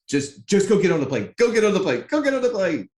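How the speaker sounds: retold frantic urging, pitch climbing with each repeat; building from insistent to high-pitched urgency; urgent urging.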